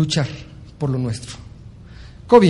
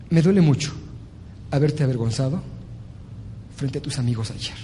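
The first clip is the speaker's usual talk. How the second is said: soft, low, heartfelt father; tender apology; 3 semitones lower, 6 dB quieter than usual. Acted. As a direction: admit your fault gently. (padre arrepentido, voz suave y baja)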